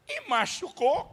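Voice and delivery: slow, high worried question; mother asking. Retold or imitated. Mother asking if son is hurt, slow and high. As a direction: stretch it out like a fussing mom. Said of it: "a mãe pergunta".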